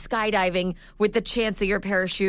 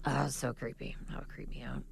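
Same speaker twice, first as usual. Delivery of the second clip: whispering; 9 semitones lower, 14 dB quieter than usual. Real, not acted. Creeped out by backwards knees.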